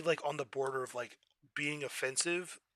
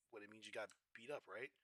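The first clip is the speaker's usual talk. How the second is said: much softer, lower confused mumble; confused quiet; 5 semitones lower, 19 dB quieter than usual. Retold or imitated. Imitating himself confused by the slang, soft and low. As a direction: mutter it, puzzled. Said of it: "I'm like".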